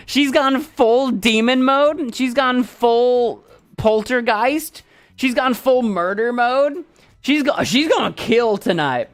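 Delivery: high, slow, drawn-out mock alarm; hammed-up doom announcement; mock horror hype. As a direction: stretch each phrase with mock dread. Hyping the cat as possessed.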